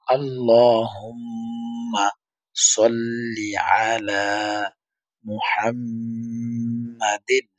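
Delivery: very slow, drawn-out আল্লাহুম্মা সল্লি আলা মুহাম্মাদিন; slow, stretched teaching recitation; slow recitation demo. Shown as a demonstration. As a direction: recite it slowly for students to echo. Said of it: "আমি যখন বলি তখন আপনারা আমার সাথে সাথে বলার চেষ্টা করবেন".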